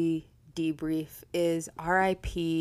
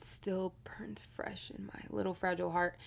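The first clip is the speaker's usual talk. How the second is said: mock-wounded whisper; 9 dB quieter than usual. Mock heartbreak over old notes.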